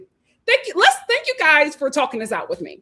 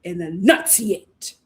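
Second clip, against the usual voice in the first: slow, emphatic scolding, word by word; deliberate mom warning, punching key words; stern mother; 3 dB louder, slower than usual. Pretend. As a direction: chew out your son over the phone. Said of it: "I would say".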